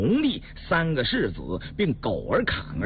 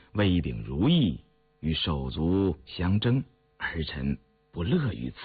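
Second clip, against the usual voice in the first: low, restrained plea to the throne; wry, humble prince; 7 semitones lower than usual. A narrator's reading. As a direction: reason humbly in a lowered voice. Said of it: "胤禛苦笑了一下". (低沉恭谨地进言)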